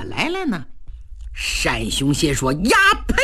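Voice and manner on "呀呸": a sharp, high, contemptuous "呀呸"; explosive cry of disgust; furious spit. Acted. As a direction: spit it out at the traitor in rage. Enraged general spits contempt.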